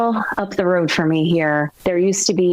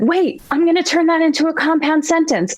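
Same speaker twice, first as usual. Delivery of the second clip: put-on high-pitched kid voice; imitated third grader; 10 semitones higher than usual. Student interrupting the announcement in class, imitated high child voice. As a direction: pipe up as a young pupil. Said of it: "a student stood up in this third grade class and said".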